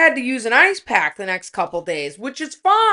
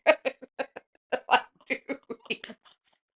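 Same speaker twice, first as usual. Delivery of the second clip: laughing; 3 semitones higher, 10 dB quieter, much slower than usual. Real, not acted. Laughing through embarrassing detail.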